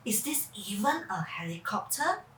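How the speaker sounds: hushed wondering question; whisper. Acted. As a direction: whisper it mysteriously. Mysterious question.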